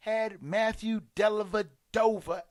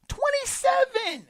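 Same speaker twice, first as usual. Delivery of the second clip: much higher and louder exclaim; incredulous shout; 9 dB louder than usual. Real, not acted. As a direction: yell it in disbelief. Outraged repeat of stat.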